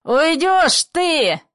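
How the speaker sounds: loud, high, drawn-out roar; furious. A narrator's reading. Father orders her out, furiously. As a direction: stretch it out in a rage. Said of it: "Бессемёнов бешено".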